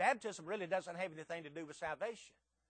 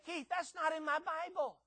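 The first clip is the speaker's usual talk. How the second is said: higher, lightly voiced protesting churchgoer; mock objector; 9 semitones higher than usual. Listener objecting to the preacher, mild mock protest. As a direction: play the doubting listener, pitched up. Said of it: "You say".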